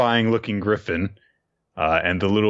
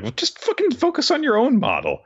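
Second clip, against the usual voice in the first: much higher, sneering brat voice; snotty Damien. Retold or imitated. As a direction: snap it like a spoiled rich boy. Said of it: "Damien's like".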